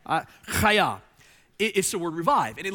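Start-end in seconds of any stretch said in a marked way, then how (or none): 0.5-1.0 Chaya with a wet, rasping kh at the start, louder; spitty guttural chaya. Shown as a demonstration. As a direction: let a little spit fly on the kh of chaya. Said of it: "A little spit coming out of your mouth".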